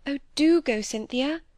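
imploring; pleading. A narrator's reading. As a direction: coax gently, like a young woman begging a favour. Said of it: "pleading with her eyes as well as her words".

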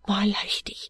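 frightened whisper; whispered fear. Acted. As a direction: breathe it, scared to be overheard. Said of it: "answers in a frightened whisper".

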